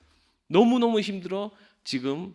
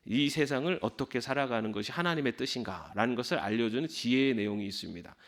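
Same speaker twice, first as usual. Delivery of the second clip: usual speaking voice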